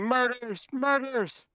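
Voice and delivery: very high, drawn cry at normal volume; a restrained scream of alarm for the table; mock screaming.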